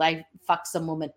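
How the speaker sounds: ordinary talk, nothing marked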